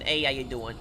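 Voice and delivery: a light New York accent greeting. Shown as a demonstration. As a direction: say it like a New Yorker. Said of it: "Can I do the New York accent?".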